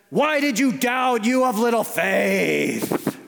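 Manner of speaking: slow, drawn-out hammed-up shout; exaggerated mad Jesus, stretching each word; mock angry scolding. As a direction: roar it as an over-the-top furious rebuke. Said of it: "If he was mad".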